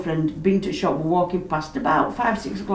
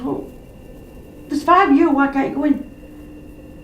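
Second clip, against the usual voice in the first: retold exasperation; 6 semitones higher, slower than usual. Woman challenging police waiting outside, exasperated. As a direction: replay an incredulous challenge, pitched up.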